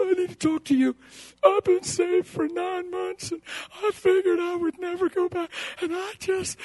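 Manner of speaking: high, soft sobbing confession; mock tearful, strained voice; imitated crying. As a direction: mimic someone crying as they confess. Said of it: "They're crying, they're crying their eyes out".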